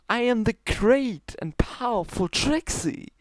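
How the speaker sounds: high, grand stage announcement; boastful Trixie. Acted. Trixie introducing herself to the stallion, grandly boastful. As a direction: proclaim yourself like a showmare.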